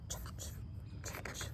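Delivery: whispered soft chuff of shoes; father's shoes on the path, whispered. A narrator's reading. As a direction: breathe each chuff like a footstep. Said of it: "They make a soft sound".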